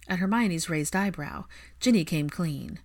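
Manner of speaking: ordinary delivery, nothing marked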